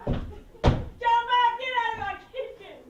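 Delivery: loud, high, outraged command; shouted order. Acted. Waitress throwing man out of her kitchen, shouting. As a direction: yell him out of the room.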